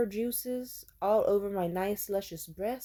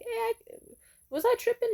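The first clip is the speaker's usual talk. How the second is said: high disbelief; 14 semitones higher than usual. Retold or imitated. Herself doubting, high squeak.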